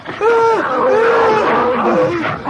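struggling groans. Acted.

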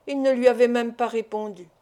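ordinary delivery, nothing marked

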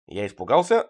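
ordinary delivery, nothing marked

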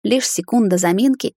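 usual speaking voice

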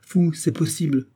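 a soldier sneering back at the officer; sneering snicker. A narrator's reading. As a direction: sneer the words with a smirking laugh. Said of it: "ricana Hermann".

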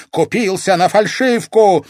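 high, loud jeer; taunt in his face. A narrator's reading. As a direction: throw the taunt out loudly. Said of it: "выдохнула ему в лицо дама теней".

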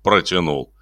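usual speaking voice

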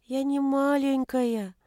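high, slow, small voice; plaintive little girl. A narrator's reading. Ashamed daughter insisting to mother, plaintive. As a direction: draw it out, wounded.

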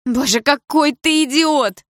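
high, loud, drawn-out exasperation; crying out. A narrator's reading. Mother insulting her jealous husband, screaming out. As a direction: shriek it in despair. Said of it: "выкрикнула мама".

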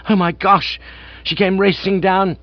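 high, dismayed exclamation; alarmed. A narrator's reading. Master learns his aunt came racing, alarmed. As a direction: cry out as the awful truth dawns. Bertie hears aunt raced down.

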